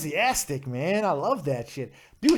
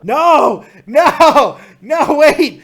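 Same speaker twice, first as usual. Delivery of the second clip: loud alarmed no; panicked shouting; 8 semitones higher, 14 dB louder, much slower than usual. Real, not acted. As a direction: yell it in alarm. Host panics, caller driving.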